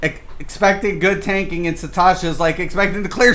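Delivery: ordinary talk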